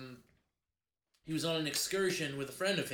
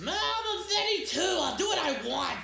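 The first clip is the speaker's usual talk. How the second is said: shouting son; 12 semitones higher, 4 dB louder than usual. Pretend. Plays the grown son arguing.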